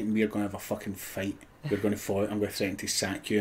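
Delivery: hushed plotting. Retold or imitated. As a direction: keep it down so the kids can't hear. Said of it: "and he goes".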